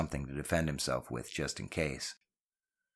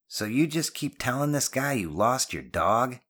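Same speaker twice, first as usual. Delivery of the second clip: higher-pitched, scoffing question; 7 semitones higher than usual. Acted. Janetti doubts Ben's lost dog story, scoffing. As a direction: question it in open disbelief. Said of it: "Janetti scoffed".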